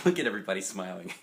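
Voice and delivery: fast, laughing through the words. Real, not acted. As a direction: giggle while pointing at the page. Reader laughs at picture.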